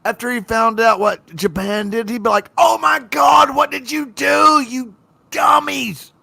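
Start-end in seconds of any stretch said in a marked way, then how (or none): 2.6-6.1 loud exasperated shout; angry shout. Retold or imitated. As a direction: scold them at full volume. Hitler imagined scolding Japan.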